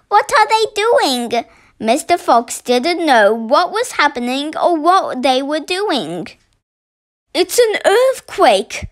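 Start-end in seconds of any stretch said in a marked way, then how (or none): 0.1-1.4 high-pitched questioning fox cub; small fox crying out. A narrator's reading. Small fox asks Dad about the noise, crying out. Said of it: "cried the small foxes". 7.3-8.9 higher-pitched exclamation from Mrs Fox; alarmed cry. A narrator's reading. Mrs Fox to her family under the banging, crying out. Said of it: "cried Missus Fox".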